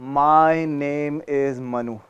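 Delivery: drawn-out name, every syllable stretched; very slow, stretched name. Shown as a demonstration. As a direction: stretch each syllable of the name on one breath. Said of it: "सिर्फ अपना नाम लो". (नाम को बहुत धीरे खींचकर बोलना)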